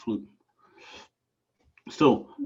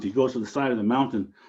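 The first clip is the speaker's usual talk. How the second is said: plain reading voice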